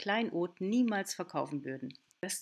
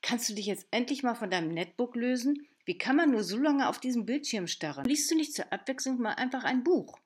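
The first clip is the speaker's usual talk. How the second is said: a hint of a nagging strict governess, higher and louder; 5 semitones higher, 5 dB louder than usual. Mother nagging daughter about her screen, lightly strict governess. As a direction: nag like Fräulein Rottenmeier. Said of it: "ich klinge wie Fräulein Rottenmeier".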